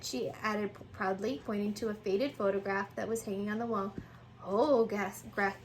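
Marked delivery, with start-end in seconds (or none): none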